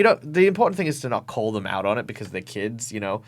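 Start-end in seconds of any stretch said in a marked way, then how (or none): none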